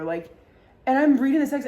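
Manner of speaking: normal talking voice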